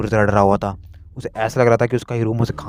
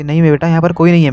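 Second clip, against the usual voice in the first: higher, louder mother insisting; reassuring mother; 6 semitones higher, 7 dB louder than usual. Acted. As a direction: mom telling son no one is there. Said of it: "मिस्सो की mom कहती". (माँ का ऊँचा भरोसा दिलाता स्वर)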